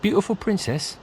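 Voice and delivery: high, sudden interest in beautiful; perked-up interest. Acted. Bard hearing of a beautiful princess, eager surprise. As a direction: ears prick up at a princess.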